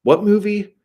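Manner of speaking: very high, loud baffled question; mock incredulous, pitch shooting up; Harry Dean. Harry Dean questioning his driver about a film, baffled. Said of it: "And he would go".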